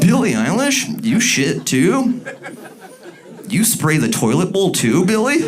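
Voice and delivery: high, laughing fake disbelief; mock shocked gasp. Ad actor gasps at Billie in mock shock. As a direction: gush in hammed-up ad shock.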